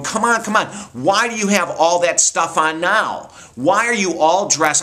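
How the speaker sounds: put-on impatient older sister calling out, pitched high; bossy big sister. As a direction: hurry the little one along, exasperated. Big sister scolding little sister.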